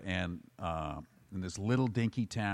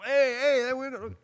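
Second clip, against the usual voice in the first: high, loud shouted protest; angry bar crowd yelling at the new owner; yelling hockey fans; 8 dB louder than usual. Retold or imitated. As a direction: bellow at the owner to stop. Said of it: "they're all start yelling at him".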